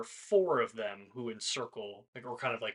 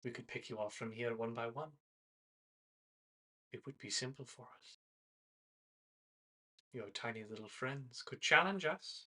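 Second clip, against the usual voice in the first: menacing hunter; 9 dB quieter than usual. Acted. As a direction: quietly threaten the prey. GM voices hunter, quiet menace.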